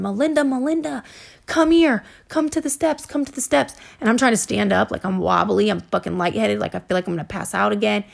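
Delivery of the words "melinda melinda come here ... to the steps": high-pitched mock calling out; imitated yelling. His mom summoning Melinda to the steps, imitated high yell. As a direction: call out her name high, at contained volume. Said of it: "And I hear her yelling".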